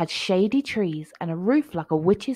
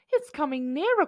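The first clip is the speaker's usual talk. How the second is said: high scared voice; teeth chattering; 7 semitones higher, faster than usual. A narrator's reading. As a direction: shiver through the words. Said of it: "his teeth chattering".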